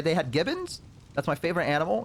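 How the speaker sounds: ordinary delivery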